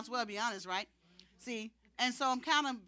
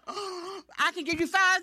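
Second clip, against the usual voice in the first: higher, slow, breathless with disbelief; the words slip out before she can stop them; gasping and amazed at her own offer; stunned blurt; 8 semitones higher, 7 dB louder, slower than usual. Retold or imitated. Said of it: "And I'm going".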